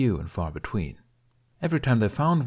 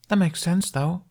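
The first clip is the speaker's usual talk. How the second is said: put-on young boy voice; 10 semitones higher, 3 dB louder than usual. Jamie's voice.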